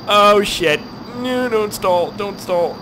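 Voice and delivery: high, urgent plea to the plane; high-pitched moment of panic; alarmed pleading. Real, not acted. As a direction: start loud, then plead as it eases off. Plane about to stall.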